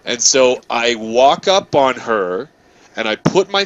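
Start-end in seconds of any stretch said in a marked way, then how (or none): none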